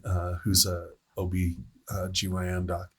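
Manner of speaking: normal talking voice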